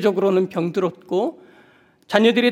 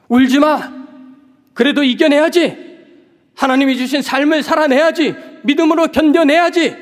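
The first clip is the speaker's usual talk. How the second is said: higher, louder harsh urging; scolding; 7 semitones higher, 5 dB louder than usual. Pretend. An imagined stern Jesus scolding the grieving widow, loud and harsh. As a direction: push her hard to stop crying. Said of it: "이렇게 다그치듯".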